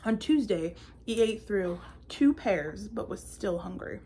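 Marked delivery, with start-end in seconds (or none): none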